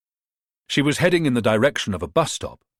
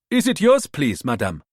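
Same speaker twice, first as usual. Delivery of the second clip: put-on bright, higher Ghanaian accent; 4 semitones higher, 3 dB louder than usual. Rita in disguise calling up to Natalia, Ghanaian accent. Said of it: "adopting the same Ghanaian accent".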